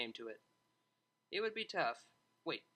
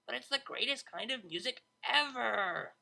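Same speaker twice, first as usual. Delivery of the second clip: high, bright excited DJ voice; excited Vinyl; 8 semitones higher, 4 dB louder than usual. Acted. Vinyl protesting to Octavia, excited and loud. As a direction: gush about dubstep with shock. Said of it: "further inciting shocked reactions from Vinyl".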